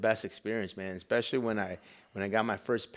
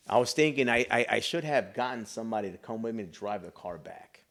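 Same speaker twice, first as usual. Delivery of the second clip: ordinary talk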